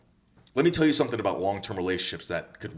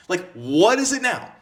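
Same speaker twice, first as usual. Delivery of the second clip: slower mock exasperated; 9 semitones higher, 6 dB louder, slower than usual. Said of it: "And you're like".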